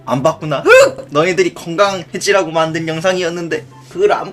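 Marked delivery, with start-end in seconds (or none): 0.6-1.1 single high, loud fake sob; squeaky pretend gasp of grief; mock sob. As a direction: burst out one exaggerated sob. Mock sob on learning it went unwatched.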